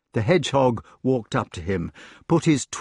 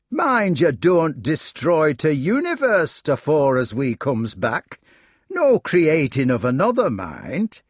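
broad dialect with slow, raised warning; mocking hedgehog; 4 semitones higher, slower than usual. Hedgehog leaving the committee with orders, mocking. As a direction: caution them with cheeky contempt.

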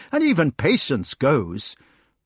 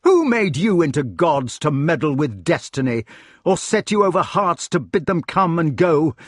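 higher-pitched, indignant tirade; raging in self-defense; 6 semitones higher, 3 dB louder than usual. A narrator's reading. Cornered king berating the clever meddlers, raging. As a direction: lash out at them, wounded and defiant. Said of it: "he had to rage in self-defense".

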